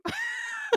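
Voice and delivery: a burst of high laughter. Real, not acted. Teller laughing at her own punchline, high and bright.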